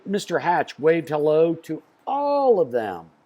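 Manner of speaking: ordinary delivery, nothing marked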